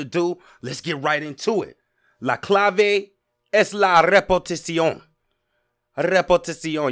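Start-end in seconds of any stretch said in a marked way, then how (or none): 2.2-6.9 Spanish with rolled R in repetición. Shown as a demonstration. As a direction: roll the R hard in repetición. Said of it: "I can roll those R's".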